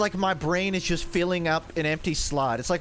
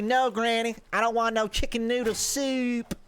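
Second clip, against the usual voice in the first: put-on Southern accent; 6 semitones higher than usual. Grandson turning down granny's soup, high Southern accent. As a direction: refuse granny in a raised Southern twang. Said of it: "I can do southern".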